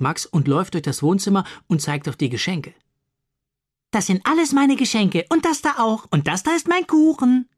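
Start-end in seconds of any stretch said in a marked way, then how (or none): 3.9-7.5 put-on high, bossy child voice claiming everything; boastful little boy. A narrator's reading. Max claims all the presents in a high, boastful little boy voice. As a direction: crow like a greedy little boy showing off.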